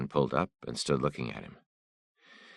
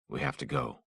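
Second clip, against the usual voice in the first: said higher and softer; first, lifted and gentle; a softer, higher first try; higher take; 12 semitones higher, 4 dB quieter than usual. Acted.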